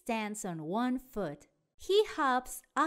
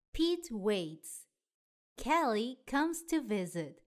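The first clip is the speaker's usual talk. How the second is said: plain reading voice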